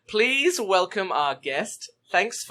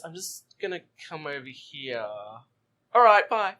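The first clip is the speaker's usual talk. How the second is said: imitated awkward exit. Host imitating the Doctor dodging, awkward exit. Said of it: "literally goes".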